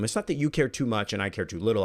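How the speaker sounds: ordinary talk, nothing marked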